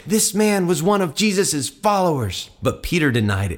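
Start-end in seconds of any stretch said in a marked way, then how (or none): 0.1-2.5 high-pitched accusing servant; pointed accusation. Acted. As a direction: point him out in a raised pitch. Servant girl accuses Peter.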